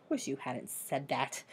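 low, soft groan; a quiet, dragging complaint. Acted. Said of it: "Camilla groaned".

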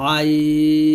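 আইন pressed hard from the middle of the throat; throaty ain. Shown as a demonstration. Teacher demonstrating the letter for students to repeat, pressed throaty. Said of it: "আমার সাথে আপনারা উচ্চারণ করেন".

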